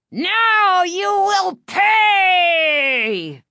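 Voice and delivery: very high, drawn-out screech; triumphant villain cry. A narrator's reading. Escaped dummy threatening the girls, triumphant screech. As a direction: screech the threat, stretching every word. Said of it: "the dummy cried".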